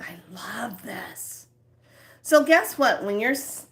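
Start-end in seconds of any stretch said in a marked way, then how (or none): 0.0-1.5 slow, soft and content; blissful sigh. Pretend. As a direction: savour every word. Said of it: "you're just saying".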